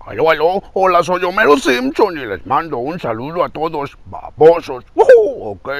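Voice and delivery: dopey cartoon dad impression; Homero Simpson. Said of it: "la voz de Homero Simpson".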